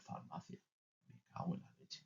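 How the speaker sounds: whispered astonishment under the breath; amazed whisper. Retold or imitated. Parent staring at the sign, whispering in amazement. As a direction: whisper it, stunned at the child. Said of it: "te quedas mirando el letrero y dices".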